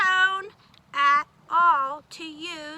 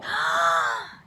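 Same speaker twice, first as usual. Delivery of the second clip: surprised gasp; 12 semitones lower than usual. Acted. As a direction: gasp as if startled.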